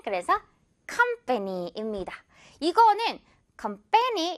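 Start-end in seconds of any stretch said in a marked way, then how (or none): 3.6-4.3 COMPANY said as com-PA-ny; misplaced middle stress. Shown as a demonstration. Teacher imitating students' mistake, "company" stressed on the middle syllable. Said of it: "이렇게 좀 실수하는 친구들".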